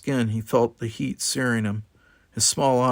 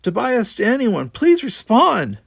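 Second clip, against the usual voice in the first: high, loud, slow desperate plea; crackling comlink call; 12 semitones higher, 6 dB louder than usual. A narrator's reading. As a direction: plead over a broken comlink. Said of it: "Tanya's voice crackled over their comlinks".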